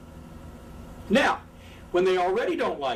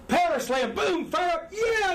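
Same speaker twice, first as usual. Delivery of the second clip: rising hammed-up hype, pitched higher; fired-up comeback; 9 semitones higher than usual. Babyface firing up after a power slam, hyped up. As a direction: pump it up like a comeback.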